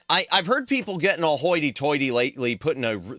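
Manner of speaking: ordinary talk, nothing marked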